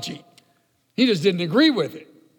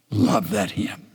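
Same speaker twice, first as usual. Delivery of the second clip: hushed aside; 10 semitones lower than usual. Real, not acted. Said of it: "aside after hymn".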